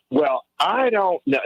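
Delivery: quoting radio caller. Retold or imitated. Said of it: "This is what she said".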